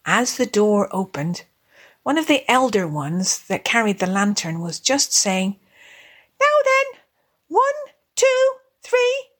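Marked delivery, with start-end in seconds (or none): 6.4-9.3 very high-pitched little mouse voice; little field mouse. Acted. Elder field mouse counts the carollers in, very high little voice. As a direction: count the singers in, high and tiny.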